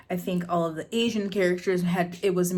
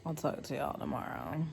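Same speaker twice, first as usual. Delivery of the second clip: tired late-night murmur; 4 semitones lower, 15 dB quieter than usual. Real, not acted.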